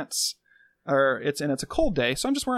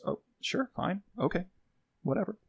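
dismissive; 3 semitones higher, 9 dB quieter than usual. Retold or imitated. Dismissive partner quote.

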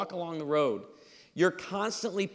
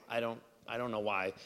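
usual speaking voice

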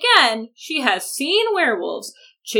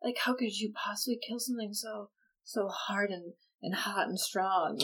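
mock swooning whisper; 3 semitones lower, 10 dB quieter than usual. Bella marveling at vampires to Jacob, hushed mock swoon. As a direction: sigh it like a lovestruck teen.

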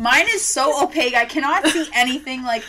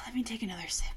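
fast, hushed whisper; 12 dB quieter, much faster than usual. Real, not acted. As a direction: whisper it to yourself. Whispering while tasting.

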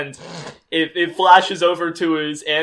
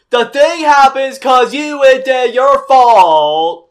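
loud, bratty mock yell, pitched up; childish yell; 8 semitones higher, 11 dB louder, slower than usual. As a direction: yell it like a spoiled child blaming someone. Childish characters voiced, loud.

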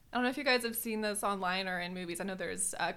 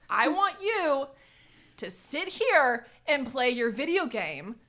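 irritated order; 4 semitones higher, 7 dB louder, slower than usual. Retold or imitated. Snapping at Wesley mid-rage.

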